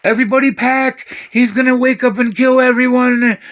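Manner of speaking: high, loud hammed-up panic; panicked yell. Mom telling everyone to flee, mock panicked shout. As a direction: scream it like the house is on fire. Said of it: "She was like".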